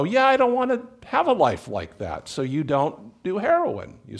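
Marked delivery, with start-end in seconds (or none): 0.0-2.3 mock onlooker. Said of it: "you go".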